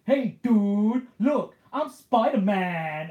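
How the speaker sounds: high, loud, slow dopey bystander; goofy dude voice. Retold or imitated. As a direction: stretch it out like a proud doofus. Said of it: "who does that voice".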